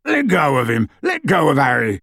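indignant shout in a big man's put-on voice; Hagrid, indignant. Hagrid ordering Lupin off Harry, indignant shouting. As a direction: protest with a rough-accented yell. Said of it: "said Hagrid indignantly".

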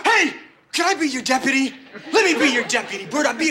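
eager pleading. Real, not acted. [name] begs to be deputy.

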